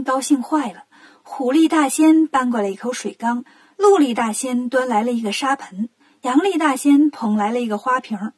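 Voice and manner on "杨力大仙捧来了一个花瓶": ordinary delivery, nothing marked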